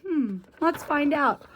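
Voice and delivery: ordinary delivery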